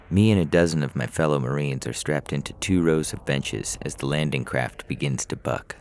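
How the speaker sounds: ordinary delivery, nothing marked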